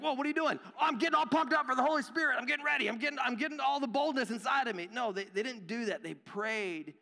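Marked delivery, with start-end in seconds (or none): 0.0-0.6 mock-startled, higher-pitched question; startled onlooker. Onlooker questioning someone pumping up, mock startled. Acted onlooker reacting to pumped-up apostles. 0.6-4.9 hammed-up hype, high, loud and rushed; mock pumped-up. Believer psyching up for the Holy Spirit, hammed-up excitement. Mocks someone psyching up for boldness.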